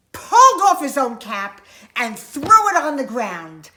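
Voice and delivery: loud, forceful narration; sudden loud storytelling burst; exasperated emphasis. A narrator's reading. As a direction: punch it out as if flinging the cap. Narrating the peddler's fed-up fling.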